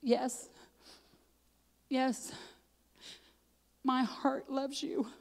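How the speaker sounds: soft, halting confession; hushed, tender imitation of a friend's answer; tender reply. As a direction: answer softly, with long pauses, from the heart.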